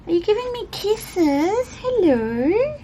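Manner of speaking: cat voice. Real, not acted. Owner cooing at her cat licking her, high cat voice. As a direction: coo up high like talking to a kitten. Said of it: "That's my cat voice".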